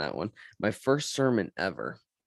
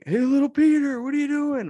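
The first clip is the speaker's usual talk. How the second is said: teasing uncle; 7 dB louder than usual. Retold or imitated. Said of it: "It'll be like".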